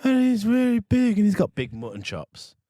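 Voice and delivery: ordinary talk, nothing marked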